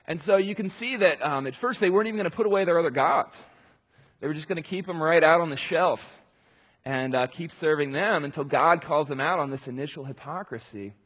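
normal talking voice

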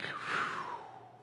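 whistling exhale. Retold or imitated. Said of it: "you're like".

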